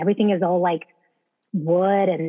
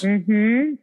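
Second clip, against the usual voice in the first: ordinary delivery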